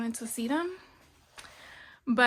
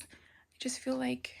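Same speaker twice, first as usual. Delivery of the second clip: slow whispered aside; whispering; 11 dB quieter, slower than usual. Real, not acted. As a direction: whisper it to yourself. Aside while fixing camera glare.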